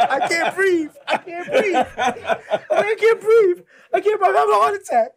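high, laughing gasps of panic; mock panic. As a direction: wheeze it out, hammed-up panic. Retold panic after practice.

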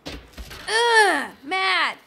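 loud, high groan fading to normal; mad, angry. Real, not acted. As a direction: vent frustration in short bursts. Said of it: "Mad! Angry".

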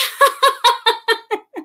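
high gasping laugh after running; breathless laugh. Real, not acted. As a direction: laugh while catching breath. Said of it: "I'm so out of breath".

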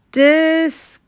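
high, loud cry of disgust; shouted disgust. A narrator's reading. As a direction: shriek your disgust at the teeth. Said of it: "shouted Little Red".

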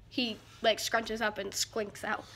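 usual speaking voice

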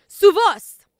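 su voz called out high and loud, voz hit hard. Shown as a demonstration. Pastor prompts the church to repeat: su voz shouted high. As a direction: lead the crowd, voz shouted up. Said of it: "Digan, ellos conocen".

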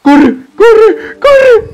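high, frantic shout to run; screaming in real panic; panicked shouting. Real, not acted. Player fleeing the monster, panicked high shout.

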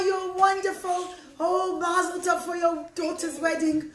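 high, gushing thanks; overjoyed. Acted. Poor father thanks his rescuer for wedding money, overjoyed. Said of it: "Yankel gets so happy".

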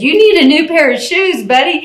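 high-pitched retold enthusiasm; acted upbeat cheer for a buddy; amazed exclamation. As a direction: exclaim it high like an impressed friend. Retelling onlookers' amazed reaction.

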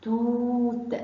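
long held vowel in توت. Shown as a demonstration. Teacher gives the example word, long oo in توت. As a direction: stretch the oo in توت before the last t. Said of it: "المد بالواو".

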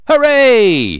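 high, loud, drawn-out hooray; cheering. A narrator's reading. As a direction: cheer it out like a whole crowd. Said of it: "Everybody cheers".

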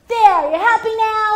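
very high, loud sing-song sulk; hammed-up sulky apology; grudging child giving in. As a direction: cave in with a sarcastic kid whine. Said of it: "and eventually".

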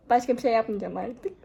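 ordinary talk, nothing marked

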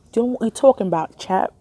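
fast hammed-up British accent, chap and all; playful imitation of an English accent; mock British accent. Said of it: "I like how people from England talk".

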